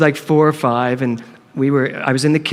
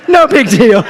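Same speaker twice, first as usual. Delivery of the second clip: playful; 5 semitones higher, 6 dB louder than usual. Shown as a demonstration. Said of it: "punchline echo".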